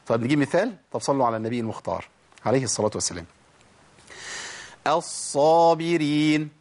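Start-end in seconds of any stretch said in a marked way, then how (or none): 4.9-6.5 الصابرين recited slowly with a long doubled ص; doubled sad. Shown as a demonstration. Teacher showing the doubled sad, slow full articulation. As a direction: hold both ص sounds in الصابرين, drawn out. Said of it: "الصاد مشددة اهي".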